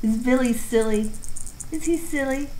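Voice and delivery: high sing-song to pets; pet talk. Real, not acted. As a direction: tease the cat gently. Cooing at cats.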